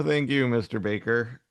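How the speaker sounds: ordinary talk, nothing marked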